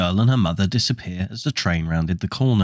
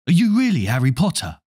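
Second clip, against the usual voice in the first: blurted out; 6 semitones higher, 3 dB louder than usual. Acted. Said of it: "Ron blurted out".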